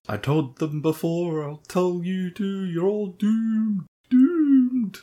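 high, slow ominous prophet voice; Crazy Ralph doom warning. Retold or imitated. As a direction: warn of doom like a crazed old man. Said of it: "he's like going".

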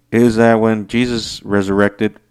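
normal talking voice

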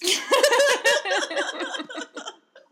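shrieking laugh; helpless high-pitched cackle; loud high laughter. Real, not acted. Breaks up reading.

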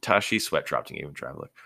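usual speaking voice

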